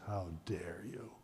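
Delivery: very low, whispered retort; imitated hushed indignation. Cora shutting up a nosy woman, whispered indignation. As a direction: whisper it as a retold rebuke. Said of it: "And Cora goes".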